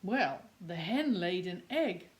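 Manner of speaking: usual speaking voice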